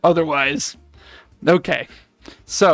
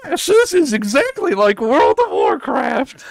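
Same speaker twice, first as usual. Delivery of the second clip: put-on old crone voice; 10 semitones higher, 3 dB louder than usual. Witch voice.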